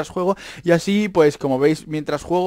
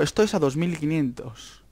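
usual speaking voice

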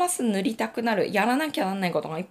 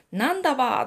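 quoted Tsugaru dialect reply, a bit raised; 5 semitones higher, 5 dB louder, faster than usual. Retold or imitated. Quoting the father's Tsugaru-dialect answer to his daughter. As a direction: toss off a short Tsugaru-dialect retort. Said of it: "津軽弁をね".

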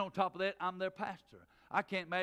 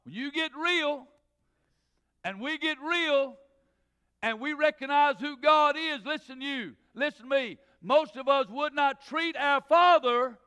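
loud, rising preacher's cadence; full-voiced sermon delivery; preaching at full force; 9 semitones higher, 12 dB louder, slower than usual. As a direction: drive it home to the pews. Preaching to congregation.

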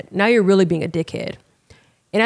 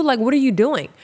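ordinary talk, nothing marked

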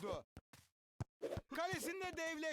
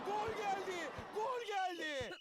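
screaming football commentator; 3 semitones higher, much slower than usual. Acted. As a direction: shout the goal again and again. Repeated goal calls, commentary.

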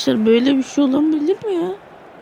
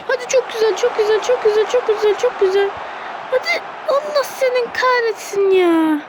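much higher, squeaky frustrated voice; strained squeal; 11 semitones higher than usual. Real, not acted. Gamer cursing the match, high strained squeal. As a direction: squeal it out in disbelief.

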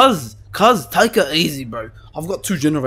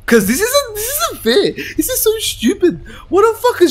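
whiny complaint. Real, not acted. Frustrated while being chased.

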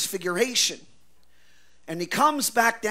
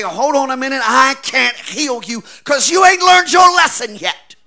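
loud, higher, scornful mock voice; mock shouted refusal; 6 semitones higher, 12 dB louder than usual. Preacher mocks an imagined Jesus refusing healing, shouting. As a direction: shout it as a sarcastic what-if.

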